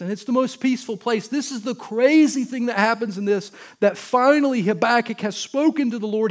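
loud, high-pitched sermon crescendo; impassioned preaching. Real, not acted. Pastor marvels that the prophet didn't run, impassioned preaching. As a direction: preach it with full force.

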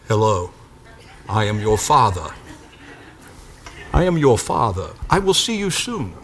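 low, soft, stately British father voice; mock British accent. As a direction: put on a British accent to greet an unborn baby. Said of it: "speaking with a British accent".

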